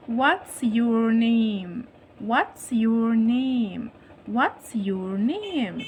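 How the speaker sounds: WHAT'S, YOUR, NAME each drawn out; slow modelling. Shown as a demonstration. Teacher drills first-graders on the question, slow and drawn-out.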